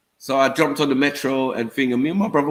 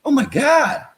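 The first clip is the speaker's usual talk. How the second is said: high-pitched mock astonishment; mimicked amazement; 10 semitones higher than usual. Stranger asking where the accent is from, mimicked high amazement. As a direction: gasp it like a starstruck local, pitched way up.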